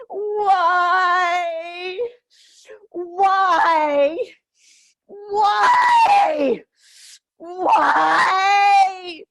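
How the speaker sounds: high, loud cries of why breaking into sobs; anguished shout. Real, not acted. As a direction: scream out the powerlessness and rage. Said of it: "express the unexpressed feelings of rage".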